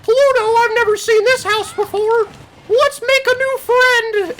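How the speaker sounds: very high, loud cartoon falsetto; Mickey Mouse. Cartoon mouse finding a strange house with his dog, high eager falsetto. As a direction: squeal it like a friendly cartoon mouse. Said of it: "He's like".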